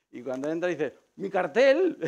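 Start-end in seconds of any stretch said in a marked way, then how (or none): 1.2-2.0 retold delighted cry, high and loud; excited shout. Old gym owner spots his sign, delighted shout. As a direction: shout it like spotting a long-lost treasure.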